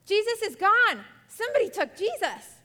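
Mary discovering the empty tomb, panicked. Acted. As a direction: shriek the news in panic. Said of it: "and she's like".

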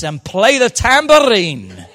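loud Scottish mum impression; imitated Scottish mother's rousing call. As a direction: draw it out in a Scottish accent. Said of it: "she would say in her Scottish voice".

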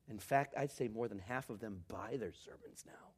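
whispered aside, like sharing a secret; confiding whisper. Real, not acted. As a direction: lean in and whisper it. Confiding an awkward truth.

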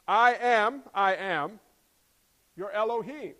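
loud, ringing declaration; forceful divine proclamation; God declaring himself. Acted. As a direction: thunder out the name, pausing between statements. Voices God declaring his name.

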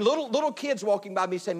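normal talking voice

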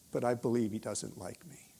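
low, soft, half-whispered retold admission; near-whispered confession. Woman confides to minister about God, near-whispered confession. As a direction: drop almost to a whisper, confiding.

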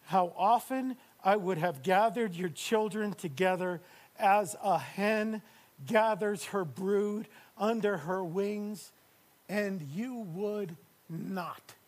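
slowed, drawn-out plea; slow pleading quote. Acted. Preacher quoting Jesus to the church, slow plea. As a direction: stretch each word like a longing call. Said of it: "Watch this".

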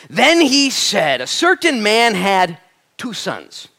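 slow, high, booming proclamation dropping on the last words; grand scripture reading. Acted. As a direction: proclaim the verse big and drawn out, then come down. Announces the verse, reads it grandly.

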